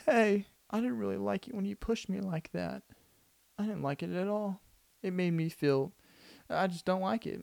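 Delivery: high, soft, timid little complaint; mock-meek, about to cry; cold reaction, near tears. Stacy timidly tells her bully off, imitating near tears. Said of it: "she starts crying and whatever".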